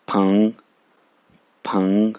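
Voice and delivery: फंग rising, neither too long nor too short; medium-length second tone. Shown as a demonstration. Teacher showing correct length of फंग, measured rising tone. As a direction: let फंग climb without dragging it. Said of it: "इतना short भी नहीं करना".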